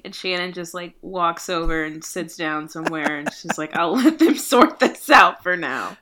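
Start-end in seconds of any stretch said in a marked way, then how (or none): 3.8-6.0 laughing. Acted. Character shrugs off the chaos.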